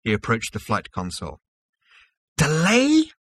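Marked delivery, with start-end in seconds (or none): 2.4-3.1 high, loud cry of disbelief; outraged cry. A narrator's reading. Zaphod cries out at the autopilot about the delay, outraged cry. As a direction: cry it out, shrill-pitched and incredulous. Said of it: "he cried".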